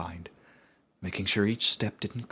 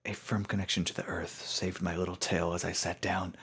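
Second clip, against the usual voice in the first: usual speaking voice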